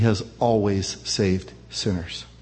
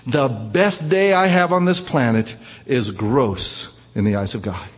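usual speaking voice

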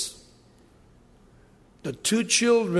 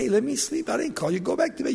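higher-pitched mock complaint; mock grumbling; 5 semitones higher, faster than usual. Priest woken by the boy, lightly grumbling protest. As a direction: wave the boy off, lightly acted.

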